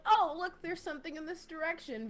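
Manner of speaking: high, quick put-on casualness; badly faked nonchalance. Jogger pretending to happen by, badly faked nonchalance. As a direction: try too hard to sound offhand. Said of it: "very not nonchalant, but trying very hard".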